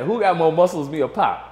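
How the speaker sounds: loud mocking dare; Suge challenging. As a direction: repeat Suge's taunt, amused. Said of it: "Suge go".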